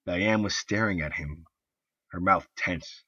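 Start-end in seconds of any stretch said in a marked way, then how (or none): none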